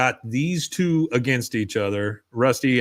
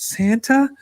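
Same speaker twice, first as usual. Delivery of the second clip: put-on high, questioning child voice; little girl; 10 semitones higher than usual. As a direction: imitate a little girl asking, pitched right up. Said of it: "A little girl comes out".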